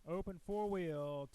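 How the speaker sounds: drawn-out announcer call; slow repeated call. Real, not acted. Announcer repeats the class call to the pits, slow and drawn out. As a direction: stretch each word out slowly, like a PA call.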